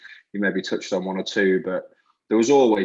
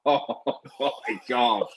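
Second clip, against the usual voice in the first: slow words broken by laughter; laughing; 2 semitones higher, much slower than usual. Real, not acted. Culprit recalling the stone hitting teacher, laughing. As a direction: laugh your way through the exclamation.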